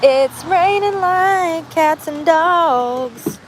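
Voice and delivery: high, slow sing-song drawl; playful high drawl about the rain. Acted. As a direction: stretch it out in a high singsong.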